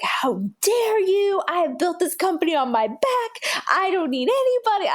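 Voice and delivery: mock outraged protest; retold angry outburst. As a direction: throw back the old offended fury, high. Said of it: "I was so mad".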